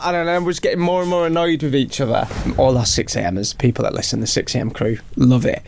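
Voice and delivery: ordinary talk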